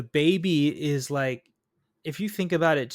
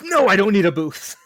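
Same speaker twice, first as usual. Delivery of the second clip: loud, higher-pitched exasperated refusal; mock indignant; 5 semitones higher, 9 dB louder than usual. Man refusing the offered booth, mock indignant. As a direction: burst out in mock outrage, half laughing.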